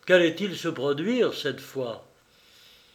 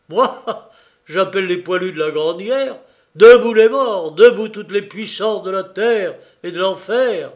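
laughing, then roaring louder and higher; delirious rallying cry; 4 semitones higher, 10 dB louder than usual. Acted. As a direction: summon the dead like a madman. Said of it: "Lupin semblait délirer".

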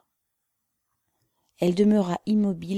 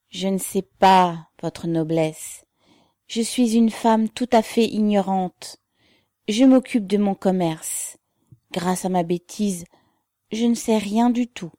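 ordinary delivery, nothing marked

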